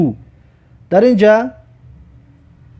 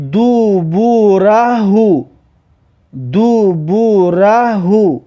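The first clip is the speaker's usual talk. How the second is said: slow syllables with held vowels; drawn-out model reading; much slower than usual. Shown as a demonstration. Teacher models a word for learners, slow and drawn out. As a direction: stretch "دوبورهو" syllable by syllable. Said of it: "و در جمله".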